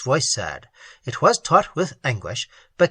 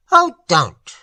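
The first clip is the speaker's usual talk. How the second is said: a louder, pained plea; quivering in pain; 4 semitones higher, 7 dB louder than usual. Acted. Gardener begging Ted not to say it, quivering. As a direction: wince as you beg him to stop. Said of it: "The first voice quivered as though in pain".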